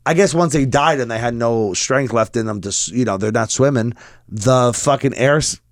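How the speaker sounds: usual speaking voice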